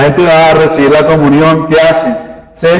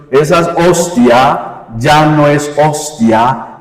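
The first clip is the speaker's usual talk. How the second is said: ordinary talk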